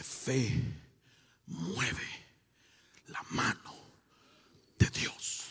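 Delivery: low, soft words with long pauses; hushed emphasis. Real, not acted. Preacher quoting the Bible on faith, hushed and deliberate. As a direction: sink to a near whisper, word by word.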